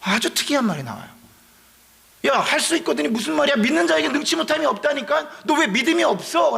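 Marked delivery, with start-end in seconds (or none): 2.3-6.6 higher, pressing retold rebuke; rebuking. Jesus scolding the father for his doubt, higher retold rebuke. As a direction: push him with each question.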